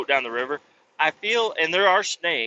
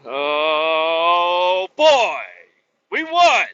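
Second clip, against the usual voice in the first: mock celebration; 3 semitones higher, 7 dB louder, much slower than usual. Mock victory cheer.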